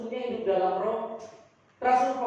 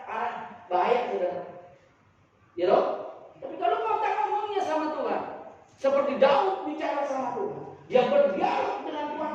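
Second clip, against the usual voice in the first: normal talking voice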